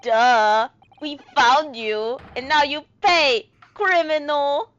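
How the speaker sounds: hammed-up Russian captor; Russian accent. Captor threatening the found criminal, forceful mock Russian accent. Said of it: "this guy is probably Russian".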